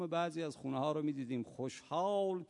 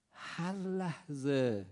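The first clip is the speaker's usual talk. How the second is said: slow weighted words; much slower than usual. Real, not acted. Slow weighted key phrase.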